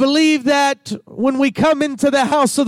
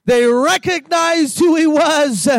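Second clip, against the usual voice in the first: rising, ringing sermon cadence; fervent pulpit call and response; full-force preaching; 4 semitones higher than usual. Acted. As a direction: preach it out to the congregation.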